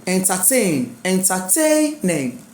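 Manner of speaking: "entertain", "Entertaining" with TAIN held; stress on TAIN. Shown as a demonstration. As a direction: lean long on TAIN in "Entertaining". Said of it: "That is just the rule".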